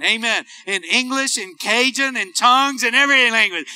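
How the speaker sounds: normal talking voice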